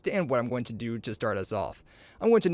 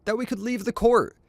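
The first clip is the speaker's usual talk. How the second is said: indignant surprise; 10 semitones higher than usual. Acted. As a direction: cry out as if cheated by the news. Character outraged by the rival's shot.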